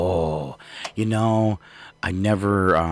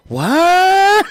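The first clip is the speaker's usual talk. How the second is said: a sudden loud, high yelp of surprise; shocked what; 7 dB louder than usual. Pretend. Guest in a bathroom spotting a sandpaper roll, startled yelp. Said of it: "you're like".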